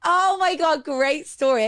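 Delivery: high, loud, gushing approval; delighted praise. Real, not acted. Cohost praising the guest's story, delighted outburst. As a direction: burst out with thrilled appreciation.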